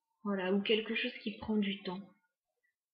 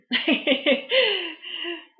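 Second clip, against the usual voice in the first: loud, high burst of laughter at herself; embarrassed laugh; 8 semitones higher, 10 dB louder than usual. Real, not acted. Laughs at her own accent.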